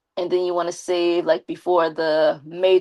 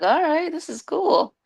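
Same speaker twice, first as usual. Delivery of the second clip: excited; 10 semitones higher than usual. Retold or imitated. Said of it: "I'm like".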